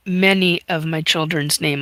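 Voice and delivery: ordinary delivery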